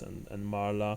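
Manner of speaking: usual speaking voice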